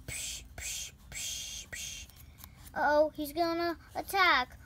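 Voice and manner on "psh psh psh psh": whispered punch sound effects. Pretend.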